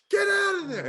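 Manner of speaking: high, loud yelled warning; retold scream. Friend repeating her movie scream, loud yell. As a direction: shout it at the screen. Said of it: "I just screamed".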